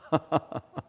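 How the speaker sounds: amused laugh to oneself; chuckle. Real, not acted. Preacher amused at how much teenagers swear, chuckling.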